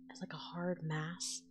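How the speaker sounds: normal talking voice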